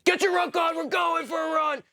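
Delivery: yelling in a sleeping recruit's face; screaming order. Retold or imitated. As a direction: bark it like a cadre waking recruits. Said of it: "this guy screaming in my face".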